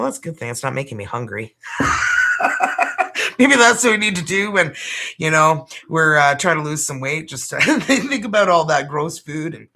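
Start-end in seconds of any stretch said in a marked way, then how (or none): 1.6-3.3 real laugh bursting out; loud laugh. Real, not acted. Laughing at her own joke. 3.4-9.7 louder, laughing through the words; real laughter through the words. Real, not acted. Laughing through her diet joke.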